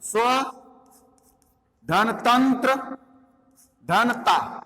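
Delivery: "स्व", "धनतंत्र", "धनता" separated by long pauses; word split apart. Shown as a demonstration. Teacher breaking a word into parts for students, long pauses between. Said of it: "तो इसमें हम अलग करेंगे इसको".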